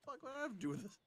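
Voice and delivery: high put-on indignant squeal; mock outrage. Joking as a dragged-in bystander, high mock outrage. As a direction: play the innocent dragged in.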